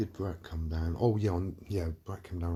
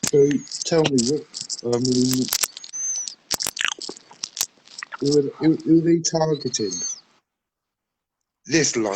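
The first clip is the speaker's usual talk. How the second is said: loud; 7 semitones higher, 11 dB louder than usual. Real, not acted. Shouting over a terrible line.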